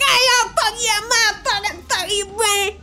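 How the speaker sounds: high, wailing cursing tirade; hammed-up imitation of a furious driver yelling; mock enraged rant. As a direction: screech it out like road rage. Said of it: "her face looked nasty".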